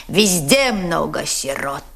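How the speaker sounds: ordinary talk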